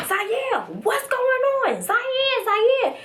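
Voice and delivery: high-pitched, urgent mock plea; insistent pleading. Pleading with boyfriend, acted.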